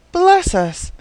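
startled, high-pitched exclamation; blank exclamation. A narrator's reading. Girl tripping over a bundle, blank exclamation. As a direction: exclaim it high, then drop away. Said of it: "she ejaculated blankly".

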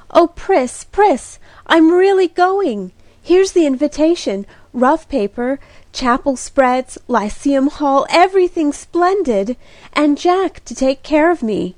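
high, loud and breathless with joy; Kitty bursts into the room thrilled, near squealing; bursting with excitement. A narrator's reading. Thrilled girl bursting in with news. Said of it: "As Kitty burst into the room".

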